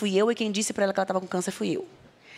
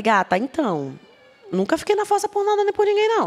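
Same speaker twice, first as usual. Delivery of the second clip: higher, sassy put-on voice; sassy retort; 12 semitones higher than usual. Guest telling the host she never moped, sassy and high. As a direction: toss it off like an unbothered diva.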